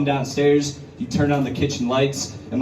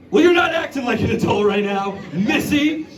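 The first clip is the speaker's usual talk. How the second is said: scolding parent; 9 semitones higher than usual. Retold or imitated. Son scolding his drunk mom, mock parental. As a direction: scold her with a wagging-finger tone. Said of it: "I was like".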